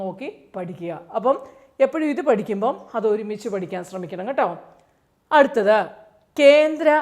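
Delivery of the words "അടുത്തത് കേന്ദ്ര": emphatic announcement. Shown as a demonstration. Tutor announcing next central institute.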